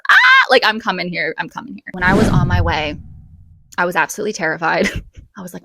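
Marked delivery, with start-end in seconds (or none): 0.1-0.4 high loud happy squeal; excited squeal. Retold or imitated. Fan thrilled by the studio name, loud high squeal. 1.4-1.9 I'm coming here repeated much softer; quiet echo. Retold or imitated. Repeating the decision to herself, much softer.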